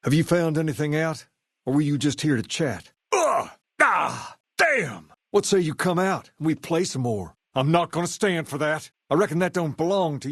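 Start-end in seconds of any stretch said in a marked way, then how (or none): 3.1-5.1 high pained grunt then a curse; sharp hurt yelps. Acted. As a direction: cry out as the blows land. Taking hits in combat.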